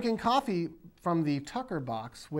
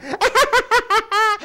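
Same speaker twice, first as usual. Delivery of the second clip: a burst of high-pitched laughter; loud high laugh; 10 dB louder than usual. Real, not acted. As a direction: crack up at your own bit. Laughs at own impression.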